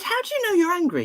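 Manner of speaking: put-on high-pitched questioning of a child; probing retold question. Therapist asking the angry boy, high and probing. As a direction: press the child for an answer.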